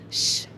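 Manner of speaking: "ch" as one hushing consonant, held; CH sound. Shown as a demonstration. Teacher showing how H after C sounds, hushed "ch". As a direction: say "ch" as a sustained hush, not letter names. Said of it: "on le prononce".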